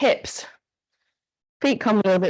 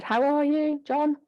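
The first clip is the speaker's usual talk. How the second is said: bright, raised friendly ask; friendly check-in question; 8 semitones higher, slower than usual. Pretend. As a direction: call out a cheery how-are-you. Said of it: "If I said".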